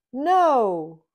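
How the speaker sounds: loud firm refusal. A narrator's reading. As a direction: refuse flatly. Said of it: "says Kitty".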